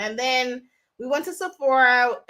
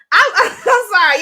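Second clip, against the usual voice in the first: louder, apologizing through a laugh; laughing apology; 7 semitones higher, 9 dB louder than usual. Real, not acted. Vlogger laughing at her own chant, giggly sorry. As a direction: crack up mid-apology.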